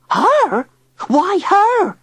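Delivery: very high, loud squawk; shrill disbelief. A narrator's reading. Tilly shrilly questioning Digby about the girl.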